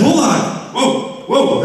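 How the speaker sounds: high, excited whoas; overwhelmed by the talking cat; amazed. Retold or imitated. Reacting to his talking cat, high and amazed. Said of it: "I said".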